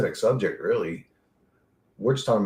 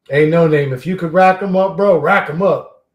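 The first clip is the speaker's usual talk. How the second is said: calling out; 3 semitones higher, 9 dB louder than usual. Real, not acted. Calling out to chat member.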